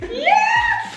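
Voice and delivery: very high, thrilled yelp; excited yes. Real, not acted. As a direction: cheer like you won. Thrilled by the gift.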